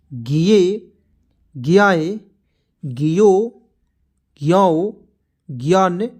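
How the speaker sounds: one drawn-out syllable at a time, long pauses between; syllable-chart recitation. Shown as a demonstration. Hindi teacher reciting the barakhadi to learners, syllable-chart recitation. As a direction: recite each letter form deliberately for learners.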